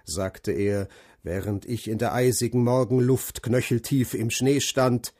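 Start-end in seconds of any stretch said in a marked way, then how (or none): none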